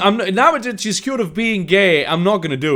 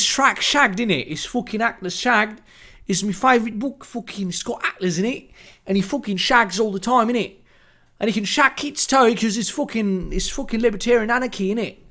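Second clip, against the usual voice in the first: a hint of a Cockney lad, innit; lightly put-on London accent, cheeky; mock British accent; 2 semitones higher than usual. Mock British Ayn Rand rant.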